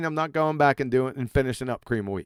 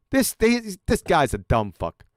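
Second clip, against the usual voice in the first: usual speaking voice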